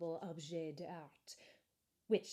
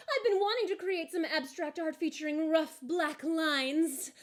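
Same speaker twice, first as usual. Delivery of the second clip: much higher, louder, rushing enthusiasm; eager boy Jasper; 9 semitones higher, 8 dB louder than usual. Acted. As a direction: gush about the art plan.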